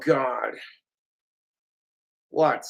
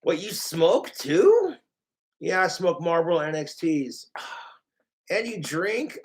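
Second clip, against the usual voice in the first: ordinary talk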